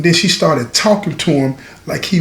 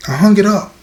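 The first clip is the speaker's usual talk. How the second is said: ordinary talk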